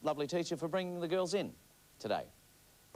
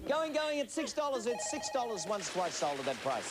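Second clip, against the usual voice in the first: auctioneer call; 6 semitones higher than usual. Pretend.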